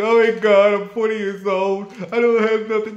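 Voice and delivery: high, loud mock wail of a panicking woman; mocking the crying woman. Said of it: "to that girl who was going crazy".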